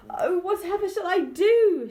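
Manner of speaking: high, loud, panicked wail; mother cries out in panic as porridge floods; distressed cry. A narrator's reading. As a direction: wail it out, at her wits' end. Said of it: "she cried".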